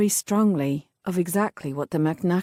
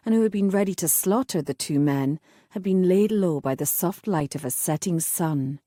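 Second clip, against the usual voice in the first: plain reading voice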